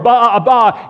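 back-and-forth baas, high and loud; sheep bleats. Acted. Two sheep trading cries in the pit, bleating. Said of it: "they go back and forth".

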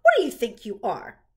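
loud scolding question; cross mother's loud demand; commanding mother. A narrator's reading. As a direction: scold a child at full volume. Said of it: "commanded his mother".

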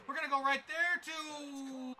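high, loud call with the last word drawn out; excited call. Real, not acted. Gamer calling his throw mid-play, excited drawn-out call. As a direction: stretch the final word as the play unfolds.

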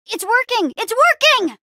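high-pitched, rising excitement; thrilled shout, bigger the second time; excited cheer. Acted. Hero seeing the plan work, excited shout. Plan succeeds mid-fight.

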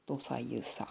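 low, soft murmur; hushed low dialect exchange, mouth nearly shut; terse dialect murmur. Shown as a demonstration. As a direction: say it quietly, barely opening the mouth. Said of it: "冬寒いから口を開かないように".

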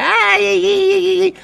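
high, whining mock lament; mock wail. Vlogger mocking a crybaby rival, high mock wailing. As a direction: wail like a child in a tantrum. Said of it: "como los niños chicos".

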